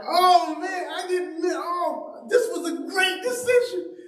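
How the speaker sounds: very high, gushing with a laugh; hammed-up naive glee; giddy mock delight. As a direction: squeal it out like a thrilled kid. Naive recruit delighted at reception.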